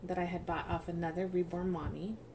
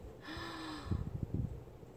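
soft gasp; 9 semitones higher, 7 dB quieter than usual. Real, not acted. Sees the Sarah Louise item.

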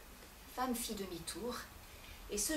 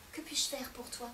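hushed tender plea; husband whispering a plea to his wife; pleading whisper; 8 dB quieter than usual. Acted. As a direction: whisper it softly to her.

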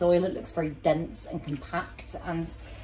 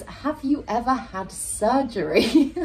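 laughing read; 5 semitones higher, slower than usual. Real, not acted. Reads weird question, laughs.

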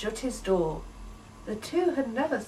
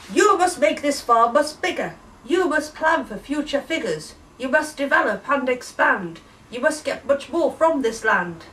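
higher, louder indignant ordering; huffy command; 5 semitones higher, 7 dB louder than usual. Acted. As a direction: huff and insist at the farmer. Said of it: "in a most tremendous huff".

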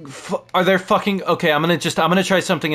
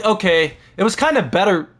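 usual speaking voice

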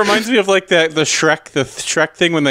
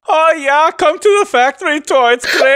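kobold villager voice; 9 semitones higher than usual. Acted. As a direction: squeak it out like an overeager little kobold. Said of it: "all the kobolds sound like that".

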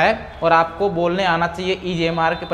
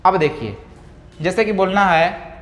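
ordinary talk

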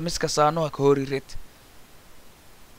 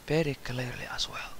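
whispered; 4 semitones lower, 16 dB quieter than usual. Shown as a demonstration. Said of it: "whispered end of model".

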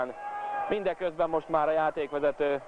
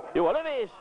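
excited commentary; 11 semitones higher than usual. Acted. Commentator reacting to shot.

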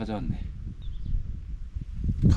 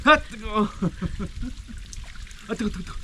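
high, pained squeals with laughter; scalding heat; 10 semitones higher, 5 dB quieter than usual. Real, not acted. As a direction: squeal from hot water on skin. Dipping feet in hot water.

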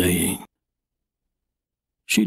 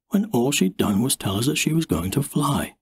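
usual speaking voice

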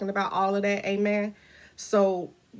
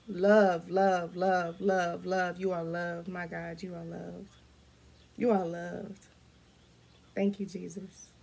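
hushed prayer; 5 dB quieter, slower than usual. Real, not acted. Murmuring to viewers that they are loved, hushed prayer. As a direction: let it sink away into reverent quiet. Prayer fading after fervent message.

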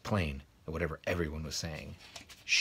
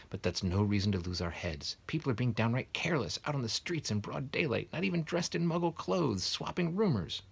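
a hint of a stern Scottish teacher, higher; lightly voiced stern McGonagall; 7 semitones higher, 4 dB louder than usual. A narrator's reading. McGonagall complaining to Dumbledore about careless wizards, lightly stern. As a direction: scold them gently, voice rising.

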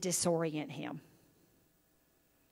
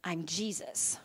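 soft, low, quiet answer; a hint of a calm divine voice; Jesus answering Saul, hushed; 5 semitones lower, 8 dB quieter, slower than usual. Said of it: "This voice said".

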